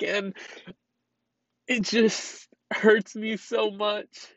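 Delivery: sobbing it out, barely holding together; crying. Real, not acted. Owner tells viewers her cat is gone, tearful.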